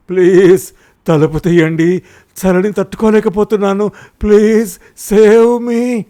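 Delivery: loud, high, desperate begging through the door; desperate pleading. A narrator's reading. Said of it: "ప్రాధేయపడసాగాడు".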